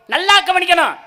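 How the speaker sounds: usual speaking voice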